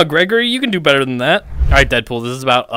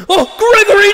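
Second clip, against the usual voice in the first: high, loud wail; playful over-the-top scream; mock-distressed cry; 4 dB louder than usual. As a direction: cry out in hammed-up dismay. Mock panic in game.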